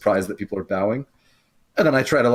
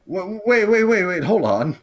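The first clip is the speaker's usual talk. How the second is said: high, loud string of waits; real startled interjection; urgent interruption; 10 semitones higher, 6 dB louder than usual. Real, not acted. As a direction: cut in urgently, higher and louder. Player urgently halts the game master.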